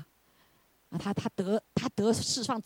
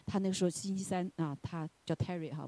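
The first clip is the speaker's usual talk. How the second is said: normal talking voice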